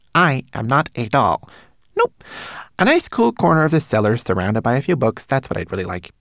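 high, loud little creature voice, affronted; indignant. A narrator's reading. Homunculus refusing to be treated as doll, indignant. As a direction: protest in an indignant squeak. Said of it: "looked at the girl indignantly".

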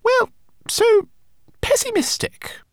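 very high, pointed little rodent; high rat voice. A narrator's reading. Rat to her gloomy companion, high and critical. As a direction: say it high with exasperation.

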